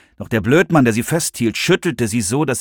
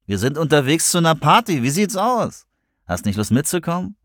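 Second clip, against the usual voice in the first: higher, coaxing come-on; wheedling pick-up voice, pitched up; cooing invitation; 6 semitones higher than usual. A narrator's reading. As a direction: coax her along like a pushy flirt. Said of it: "gurrte der Typ weiter".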